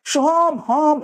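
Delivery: "Soham, হম" high and held, tail repeated; half echo demo. Shown as a demonstration. Teacher shows half a word returning, "Soham, হম" called high. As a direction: call "Soham" and bounce back only "হম". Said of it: "এই অর্ধেকটা শুনতে পাবে".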